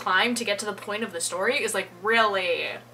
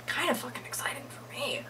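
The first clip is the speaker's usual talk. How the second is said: whispered aside; 3 semitones higher, 7 dB quieter than usual. Real, not acted. Aside about unpacking.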